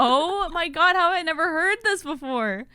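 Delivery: high, loud retold astonishment; astonished. Streamer retelling hearing mom's thick accent, astonished. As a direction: raise pitch and volume in retold disbelief.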